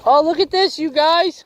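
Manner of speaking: excited squeal. Real, not acted. Excited at tiny shark catch.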